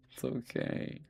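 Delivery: whispered reassurance. Real, not acted. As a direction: whisper it's fine to Ben. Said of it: "reassuring Ben".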